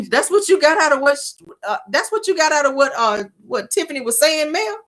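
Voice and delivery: high, incredulous questioning; confused disbelief. Retold or imitated. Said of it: "she's looking confused".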